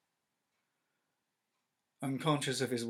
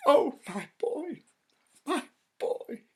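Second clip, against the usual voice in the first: desperate plea; 15 dB louder than usual. Acted. Wife pleads for her dead boy, high and anguished. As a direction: beg through anguish.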